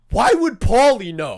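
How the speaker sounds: loud, high, drawn-out retold challenge; mock indignant. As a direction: act out an affronted outburst. Retells an indignant challenge from relative.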